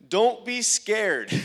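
high, loud and drawn out, laughing; elated exclamation. Retold or imitated. Preacher relaying what Jesus told the woman, elated. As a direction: proclaim it slowly, bursting into a laugh. Retelling Jesus's answer with elation.

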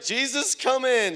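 high, loud retold prayer; fervent prayer cry. Preacher voicing the woman repeating the prayer, fervent cry. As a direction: call out to Jesus at full voice.

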